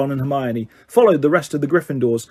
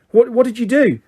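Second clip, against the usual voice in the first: anxious; 7 semitones higher than usual. A narrator's reading. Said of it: "looking anxious".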